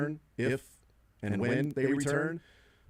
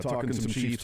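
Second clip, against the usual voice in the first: ordinary delivery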